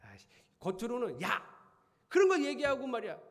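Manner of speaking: loud, high, sharp mock rebuke; father pretending to scold his son. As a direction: put on a show of scolding the son.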